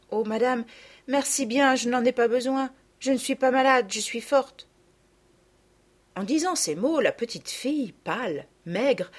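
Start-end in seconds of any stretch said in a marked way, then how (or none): 0.1-3.8 lightly voiced girl, a little higher; a touch of a proud poor girl. A narrator's reading. Girl insists she is fine. 3.9-4.7 put-on girl's je suis forte said with a lifted, firm rise; brave insistence. A narrator's reading. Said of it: "répéta d'une voix faible et éteinte".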